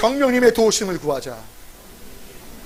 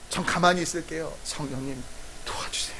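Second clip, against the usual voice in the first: trailing off into a whisper, much softer; fading whisper; 5 semitones lower, 11 dB quieter than usual. Shown as a demonstration. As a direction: let it die down to a breath. Demonstrated surrender prayer. (성령님께 맡기며 점점 잦아드는 속삭임)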